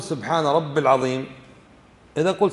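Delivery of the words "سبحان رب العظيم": رب العظيم run together as rabb-il, no ya on رب; wrong pronunciation. Shown as a demonstration. Teacher warns students not to say this, mispronounced demonstration. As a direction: drop the ya: say رب straight into العظيم. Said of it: "لا تقول".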